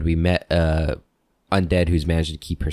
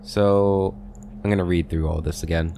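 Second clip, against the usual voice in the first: usual speaking voice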